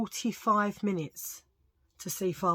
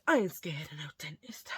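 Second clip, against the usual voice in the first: whispered boast; 3 semitones lower, 4 dB quieter than usual. Acted. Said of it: "repeats the boast as a whisper".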